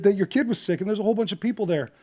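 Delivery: normal talking voice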